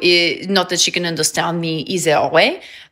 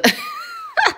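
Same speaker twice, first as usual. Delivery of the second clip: high squeal laugh. Real, not acted. Dog squishing things.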